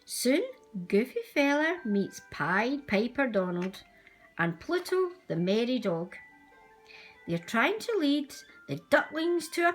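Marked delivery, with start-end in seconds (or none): none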